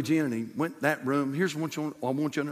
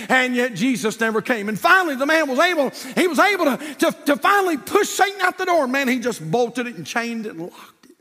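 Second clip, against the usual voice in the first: preaching at full force; 10 semitones higher, 7 dB louder than usual. Acted. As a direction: pile up the sins louder each line. Preacher finishes the fight.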